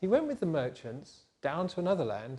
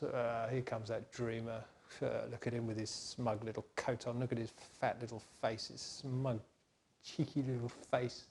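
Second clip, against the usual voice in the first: muttered sneer; 5 semitones lower, 9 dB quieter than usual. Acted. Brothers quietly sneering as Joseph approaches, scornful. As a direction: mutter it with contempt.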